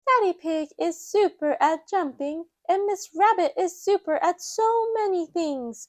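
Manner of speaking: put-on high child voice; high little piglet; Peppa. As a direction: list it like a small girl. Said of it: "Peppa says".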